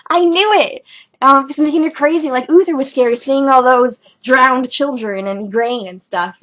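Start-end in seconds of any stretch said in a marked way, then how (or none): 0.1-0.9 high, loud triumphant gloat. Retold or imitated. Fan gloating at her correct guess, loud and triumphant. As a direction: crow it out, so proud of yourself. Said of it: "I'm like".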